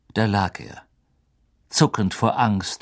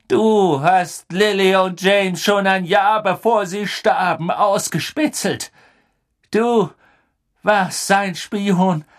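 higher, louder, slow furious charge; furious accusation; 8 semitones higher, 3 dB louder than usual. Acted. Sirius furiously accuses Pettigrew of spying. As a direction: spit each word at the traitor.

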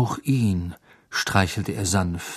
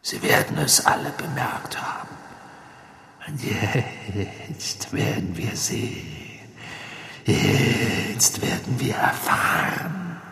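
whispering dark lord; 2 semitones higher, 4 dB quieter than usual. A narrator's reading. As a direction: gloat in a cold whisper. Villain voice held in reading.

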